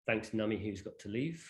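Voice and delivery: ordinary talk, nothing marked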